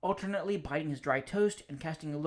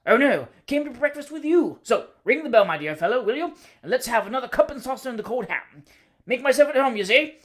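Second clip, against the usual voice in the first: hearty greeting, much louder; top of his voice; 8 semitones higher, 9 dB louder than usual. Acted. Cousin bursts in on the bachelor, booming at full voice. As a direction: boom the greeting across the room. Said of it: "He always spoke at the top of his voice".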